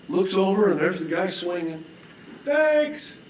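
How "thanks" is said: high, cheery thanks; dangling man. Acted. Dangling man thanking from the rope, high and carefree.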